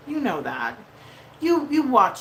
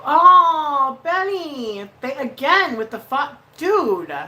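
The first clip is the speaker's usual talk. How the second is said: excited; 7 semitones higher, 9 dB louder, much slower than usual. Real, not acted. Thrilled reaction to a donation.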